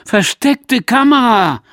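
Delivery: shouted high and slow in outrage; angry shout. Acted. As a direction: yell it, furious at the trick. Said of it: "und ich schreie".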